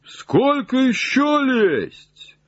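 high, drawn-out call from far off; distant call. Acted. Jupiter calls down from high in the tree, distant high call. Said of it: "как будто издалека".

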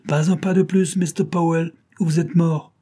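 a villain threatening the detective at gunpoint; menacing threat. A narrator's reading. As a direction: stop him cold with the gun.

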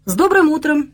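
bright lift, high-pitched good morning; cheerful greeting. A narrator's reading. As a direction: greet them merrily. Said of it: "весело поздоровался я".

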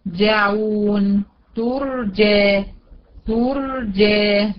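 ترجى very slow with thick held R; drawn-out demonstration, full R in ترجى; heavy still ra. Shown as a demonstration. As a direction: hold a heavy R after the u in ترجعون. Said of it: "لقيتها مضمومة".

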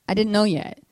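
usual speaking voice

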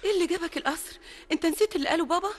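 loud, high, startled rebuke; alarmed outcry. Acted. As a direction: cry out in alarm. She alarmed at suitor's arrival.

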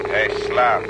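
eerie radio reply. Acted.

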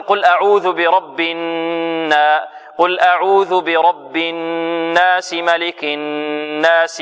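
slow, chanted tajweed recitation holding the ghunna; Quranic recitation demo. Shown as a demonstration. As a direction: recite slowly, letting the nasal hum ring. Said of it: "هل هي الآن زمنها مثل زمن الألف".

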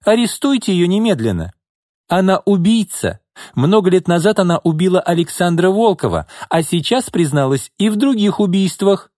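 furious denial, voice soaring; angry. Acted. Izolda angrily denies knowing her sister. Izolda orders arrest in rage.

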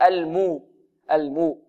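"المو" with a clearly pursed "oo" on the meem; rounded lips. Shown as a demonstration. Teacher shows the meem's damma, rounded "oo" in "المو". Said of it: "نضم شفتينا".

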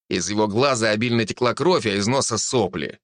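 ordinary delivery

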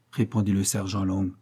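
ordinary delivery

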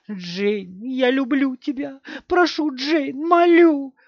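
high voice, building to a loud, drawn-out plea; desperate pleading. A narrator's reading. Мать молит призрак дочери. (отчаянная мольба, всё выше и громче)

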